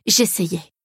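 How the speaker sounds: plain reading voice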